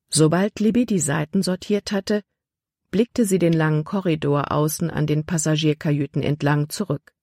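plain reading voice

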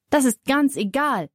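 a touch of a cross mother; understated annoyed reply. A narrator's reading. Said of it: "sagt Mama ärgerlich".

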